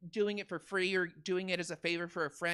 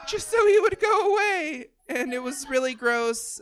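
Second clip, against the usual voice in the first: laughing cry; 7 semitones higher, 11 dB louder than usual. Real, not acted. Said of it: "laugh cry".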